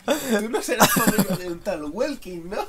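high, loud, slow silly voice. Acted. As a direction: ask it in a comic squeaky voice. Joking in a put-on voice.

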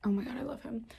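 breathy hushed whisper, pitch lifted. Real, not acted. Cooing over her sleeping dog in a whisper. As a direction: whisper it with love.

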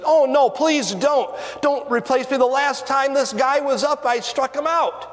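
high-pitched, urgent begging; pleading rookie pitcher. Acted. Rookie pitcher begging the manager to stay in, high and pleading. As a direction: plead your case, pitched well up.

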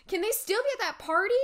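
high, loud, fast and urgent; anxious question. Acted. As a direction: rush the hopeful question out.

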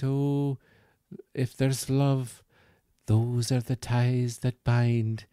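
soft, tender Scottish lilt, drawn out; Missus Doubtfire. Acted. Bear toy consoling a child, soft Scottish voice. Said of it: "very soft Scottish way of talking".

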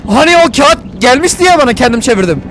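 high, loud excited outburst; alarmed realisation. Real, not acted. Streamer realises they will break the man out, alarmed. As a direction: blurt it out in rising alarm. Realises a helicopter jailbreak is happening.

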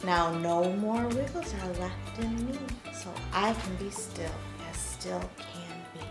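bright, bouncy action-song leader; kids show host. Acted. Leads a wiggle song with kids.

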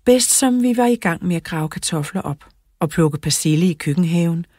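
ordinary delivery, nothing marked

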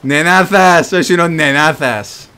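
shouted taunts at the enemy; mocking shout. Real, not acted. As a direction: yell the insult, drawn out. Taunting enemy ship in game.

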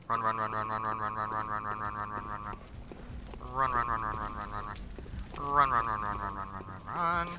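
being chased. Real, not acted. Fleeing enemies in game.

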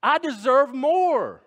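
high, loud, building entitled complaint; escalating indignant whine ending in a high loud cry; victim whine. Acted. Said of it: "caught up in the victim mentality".

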